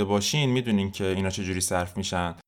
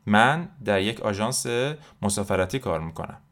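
normal talking voice

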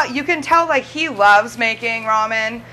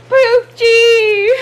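put-on crying old lady; 10 semitones higher, 6 dB louder than usual. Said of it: "one of the older ladies goes".

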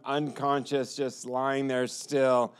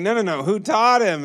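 much higher, louder urgent no no no; insistent disbelief; 7 semitones higher, 7 dB louder than usual. Retold or imitated. Rescuer refusing to believe the boy untrained, loud insistent. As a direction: press for the answer.

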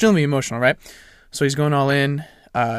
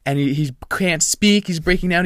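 normal talking voice

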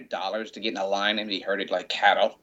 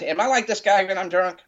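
Ace; 9 semitones higher, 3 dB louder than usual. Retold or imitated. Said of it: "yeah, that was Ace".

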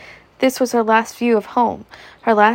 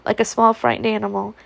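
ordinary delivery, nothing marked

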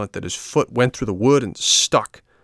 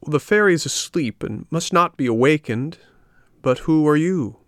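high snail voice; 7 semitones higher than usual. A narrator's reading. Snail character voice.

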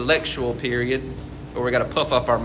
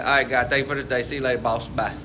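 hurried casual prayer; 4 semitones lower, faster than usual. Pretend. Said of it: "be like".

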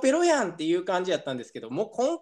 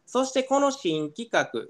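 usual speaking voice

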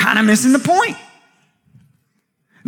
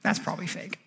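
soft skeptical mock; 9 semitones lower, 11 dB quieter than usual. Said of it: "and go".

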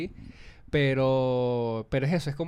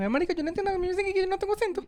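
very high-pitched mock Venezuelan protest; venezolano que niega su acento; faster than usual. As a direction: squeak out a caricatured denial. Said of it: "escuchaba a mucha gente así". (caricatura venezolana muy aguda)

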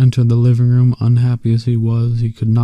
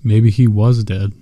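ordinary delivery

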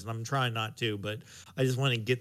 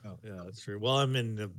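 normal talking voice

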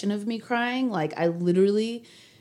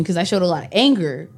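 normal talking voice

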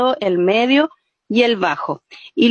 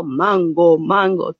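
low tone call; 6 semitones lower, slower than usual. Shown as a demonstration. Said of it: "la idea del ejercicio es que yo los voy guiando".

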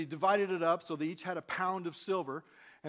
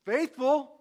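higher, emphatic royal commendation; exclaimed praise; 5 semitones higher, 6 dB louder, slower than usual. A narrator's reading. King commending his good servant, exclaimed praise. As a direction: build to a loud final word. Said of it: "the king exclaimed".

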